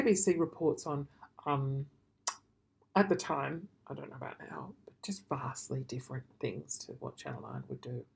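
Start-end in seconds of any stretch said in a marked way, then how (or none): 3.9-8.0 unvoiced hushed aside; whispered, confiding aside. Real, not acted.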